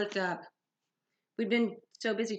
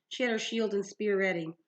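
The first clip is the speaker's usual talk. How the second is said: usual speaking voice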